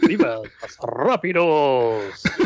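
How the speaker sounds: slow, drawn-out mock Mexican flourish; Mexican-style announcer. Suggesting a radio style to friends, drawn-out Mexican accent. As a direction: roll and stretch it in Mexican style. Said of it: "More the Mexican style".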